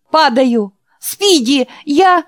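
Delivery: high, loud panicked yell; panicked cry. Acted. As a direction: cry out as you lose your footing. Jack slipping, falling from stairs.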